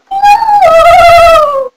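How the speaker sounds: loud, high drawn-out yell; long wailed no. Acted. Refusing the candy seeker, long high wail.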